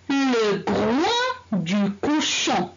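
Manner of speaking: Le groin du cochon, slowly with each word apart; slow, careful teacher read-aloud; slow model reading. Shown as a demonstration. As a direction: read slowly so pupils can follow. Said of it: "Troisième liste. Allez".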